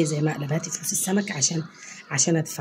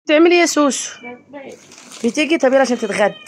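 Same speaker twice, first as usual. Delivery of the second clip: raised calling voice; 6 semitones higher, 6 dB louder, faster than usual. Real, not acted. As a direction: call her to lunch loud and high. Calling wife to lunch.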